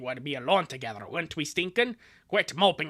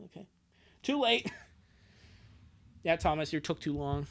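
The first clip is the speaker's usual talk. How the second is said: ordinary talk, nothing marked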